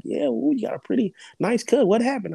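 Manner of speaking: a hint of a curious doctor; lightly voiced doctor. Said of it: "the doctor was like".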